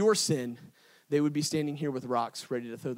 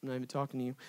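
softer, acted brush-off; dismissive; 3 semitones lower, 7 dB quieter, much faster than usual. Jesus ignores the questioning crowd, dismissive. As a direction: brush them off under the voice.

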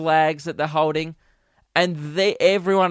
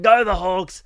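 raised-voice footy chant; retold yell; 3 semitones higher, 5 dB louder than usual. Trying to piss off Richmond supporters, raised yell. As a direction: call it out loud like a fan. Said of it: "I just yelled".

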